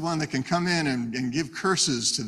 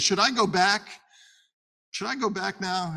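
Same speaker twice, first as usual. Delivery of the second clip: mildly nervous, higher-pitched question; a little scared; 7 semitones higher, 3 dB louder than usual. Acted. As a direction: ask timidly in a raised voice, acting it lightly. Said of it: "he's a little bit scared at this point".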